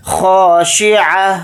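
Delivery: خاشعہ with a full, heavy kha; slow demo word. Shown as a demonstration. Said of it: "خوا کو یہاں پر موٹا یعنی پر پڑھیں گے".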